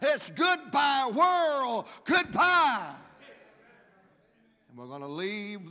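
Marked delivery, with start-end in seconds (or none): 0.0-2.9 from a half-sung line building to a high, loud shout; a rising preacher's cry, slow and loud at the peak; preaching at full force. Acted. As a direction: whoop it up into the goodbye.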